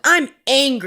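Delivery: hammed-up angry four-year-old; mad little boy. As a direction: play the child's stubborn outburst. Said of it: "he's mad all the time".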